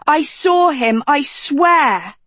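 high-pitched pleading; high pleading Dot. A narrator's reading.

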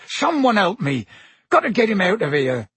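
high, urgent plea for help; urgent call. A narrator's reading. Hagrid needing help with injured Malfoy, calling urgently. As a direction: call out in alarm.

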